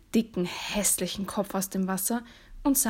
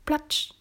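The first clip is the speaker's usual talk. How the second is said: short wet slap, raised above normal speech; imitated splat; 7 semitones higher than usual. A narrator's reading. Frog climbing the marble steps, splashy imitation.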